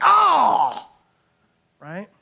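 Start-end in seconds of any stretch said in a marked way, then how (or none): 0.0-0.9 big drawn-out shouted "Oh!" falling in pitch; loud "Oh!". Acted. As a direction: burst out with a high, loud "Oh!". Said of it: "So we're like".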